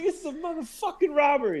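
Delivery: high hammed-up robber voice; robber's demand. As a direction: mimic the robber announcing the holdup. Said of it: "He's like".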